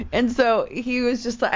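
ordinary talk

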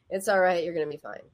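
low, brusque false comfort; not encouraging. Shown as a demonstration. Demonstrating comfort that wouldn't help anyone struggling, not encouraging. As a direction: say the comfort curtly, dropping at the end. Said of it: "That is just not encouraging at all".

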